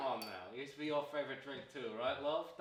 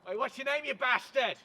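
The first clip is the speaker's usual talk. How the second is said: high, loud demand; angry shout; 10 semitones higher, 10 dB louder than usual. Acted. Man demanding a stranger's name, shouting angrily. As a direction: yell the question as an insult.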